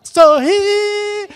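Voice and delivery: bright, loud cheerful call; cheerful shout. Shown as a demonstration. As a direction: belt it out joyfully. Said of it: "vamos falar". (grito alegre e animado)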